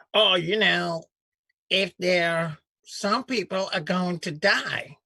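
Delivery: lightly mock the senator's good old boy accent; imitating senator's drawl. Host mocking a senator's folksy Southern drawl. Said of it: "You gotta sell that accent better".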